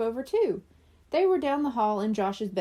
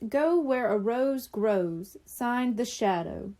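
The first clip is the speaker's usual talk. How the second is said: reading the note; slower than usual. A narrator's reading.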